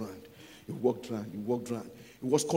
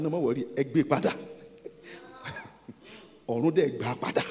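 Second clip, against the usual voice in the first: usual speaking voice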